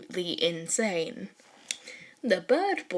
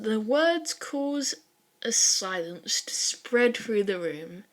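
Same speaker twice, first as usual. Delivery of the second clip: plain reading voice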